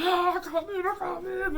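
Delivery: high, loud panicked cry; imitating trapped boy crying out; panicked pain. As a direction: beg them in pain. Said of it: "he's like".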